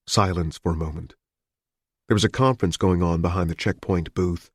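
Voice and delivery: usual speaking voice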